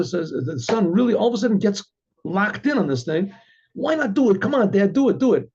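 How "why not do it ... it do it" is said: put-on higher-pitched, insistent child's plea; eager pestering. Son urging his dad to do it, eager and insistent. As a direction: egg Dad on excitedly.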